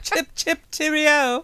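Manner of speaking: high, hammed-up posh British accent; mock British accent. Husband mocking British speech for the viewer, high mock British accent. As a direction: squeak out a silly British toff impression.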